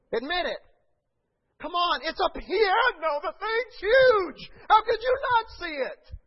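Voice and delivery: high, loud, slow incredulous cry; preaching exclamation. Acted. Pastor urging the church to admit it, loud cry. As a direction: shout the disbelief.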